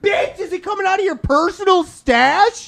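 very high-pitched indignant challenge; heated outrage. Pretend. Co-host challenging the stingy cashier, heated outrage. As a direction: squawk it in hammed-up disbelief. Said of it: "that just got me fucking heated".

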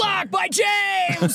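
high, drawn-out play-by-play shout; mock sports announcer. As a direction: call it like a huge block. Mock sports commentator call.

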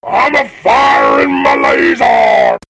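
loud, high, slow robot shout. Retold or imitated. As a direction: bellow it like a cartoon robot. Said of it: "That's a cool sound cue for that".

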